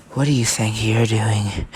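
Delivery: low, creepy voice at their ear; menacing sneak-up. Acted. Deku sneaks up between their heads.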